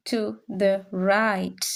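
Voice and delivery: To the right said slowly, falling at the end; slow model. Shown as a demonstration. Teacher slowly modelling the English phrase. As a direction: draw out To the right deliberately. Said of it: "teacher models phrase".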